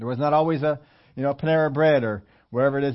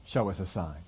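much lower and softer, brushed aside; a muttered mocking echo of the Pharisees; dismissive echo; 9 semitones lower, 8 dB quieter than usual. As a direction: toss their demand away under your breath. Pharisees' demand quoted once more.

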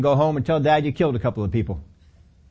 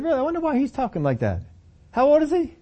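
curious king; 10 semitones higher than usual. Acted. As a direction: wonder aloud like a puzzled king. Saul's curious voice.

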